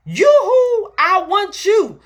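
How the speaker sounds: high, loud sing-song yoo-hoo; playful calling out. Acted. Preacher calling playfully to the things she wants, high and loud. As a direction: call out to get its attention.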